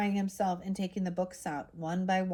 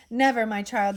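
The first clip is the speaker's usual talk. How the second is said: firm, louder denial; emphatic; 3 semitones higher, 8 dB louder than usual. A narrator's reading. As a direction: plant the word Never firmly. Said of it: "the professor replied emphatically".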